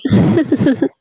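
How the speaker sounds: a short giving-in laugh. Acted. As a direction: laugh as the anger melts. Wife laughs, anger fading.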